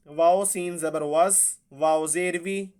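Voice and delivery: normal talking voice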